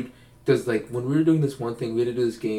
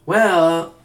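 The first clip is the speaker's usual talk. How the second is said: put-on higher, breezy party-girl voice; carefree festival girl impression, pitched up; imitating a Coachella fan; 4 semitones higher than usual. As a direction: sell Coachella as the cure for everything. Imitating the Coachella expert's answer.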